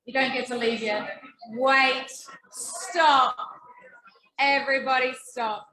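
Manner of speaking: loud sharp call for attention; teacher voice. Real, not acted. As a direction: stop the room like a strict teacher. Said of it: "This is my teacher voice".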